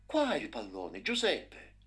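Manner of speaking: loud call to his brother. A narrator's reading. Kevin calling his brother.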